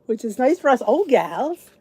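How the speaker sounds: ordinary talk, nothing marked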